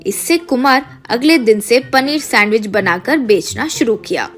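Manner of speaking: usual speaking voice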